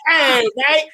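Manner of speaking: hammed-up Australian accent, slow and high; a laughing mock Australian drawl; bad Australian impression. Mocking his friend's accent in a bad Australian voice. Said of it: "that is his genuine Australian impression".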